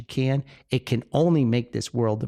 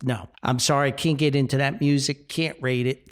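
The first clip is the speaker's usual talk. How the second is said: ordinary delivery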